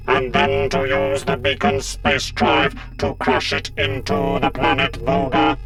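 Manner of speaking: slow, loud synthetic monotone; buzzing mechanical drone; menacing robot leader. A narrator's reading. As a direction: explain the plan like a pitiless machine. Cyber Leader reveals plan to Sarah.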